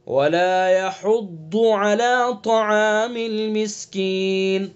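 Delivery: slow, chanted recitation of the verse; Quran recitation. Acted. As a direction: recite the verse slowly and melodically.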